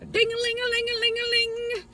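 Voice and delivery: high sing-song bell imitation; imitated bell ringing. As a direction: ring it out like a little bell, very high.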